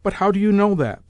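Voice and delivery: retorting. Acted. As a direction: snap back at the landlord. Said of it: "the old lady retorted".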